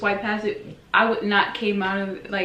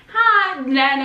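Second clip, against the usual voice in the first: higher, louder sing-song hi; awkward greeting; 5 semitones higher, 5 dB louder, slower than usual. Retold or imitated. Meeting Nana for the first time, awkward high greeting. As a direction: greet grandma with forced cheer. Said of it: "It was like".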